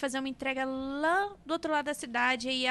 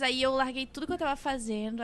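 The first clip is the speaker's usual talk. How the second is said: ordinary talk, nothing marked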